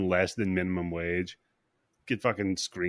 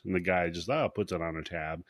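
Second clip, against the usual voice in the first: normal talking voice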